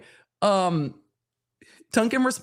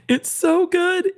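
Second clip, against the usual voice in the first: high, slow, gushing; stretched high delight; delighted squeal; 11 semitones higher, 3 dB louder, slower than usual. Real, not acted. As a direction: squeal it out with glee. Delighted squeal over brand replies.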